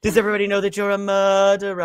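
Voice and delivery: mock dramatic accusation; acted accusation. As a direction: call him out as a murderer. Retelling a film scene.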